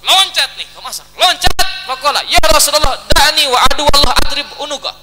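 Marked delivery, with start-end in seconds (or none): 2.3-4.9 loud, raised acted outburst; heated demand. Ansar man leaps up before the Prophet, heated demand. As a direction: burst in, pleading loudly for permission.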